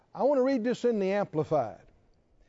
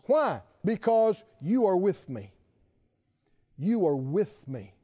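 usual speaking voice

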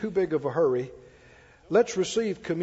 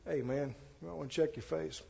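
cool and discreet; 11 dB quieter than usual. Retold or imitated. Pastor demonstrating a discreet tip to a brother, quietly. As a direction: say it softly so only he hears. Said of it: "try it coolly".

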